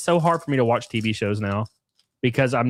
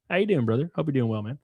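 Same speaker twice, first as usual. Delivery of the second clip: ordinary delivery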